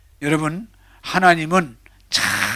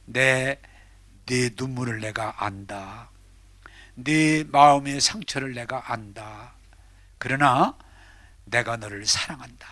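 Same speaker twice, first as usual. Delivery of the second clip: voice of God; 5 semitones lower than usual. Acted. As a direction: speak as God comforting, slowly. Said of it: "하나님이".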